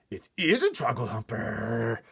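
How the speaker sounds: slow, loud, high exasperation; exasperated cry. A narrator's reading. Giant corrects Sophie, exasperated cry. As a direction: snap back, fed up. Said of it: "cried the exasperated B F G".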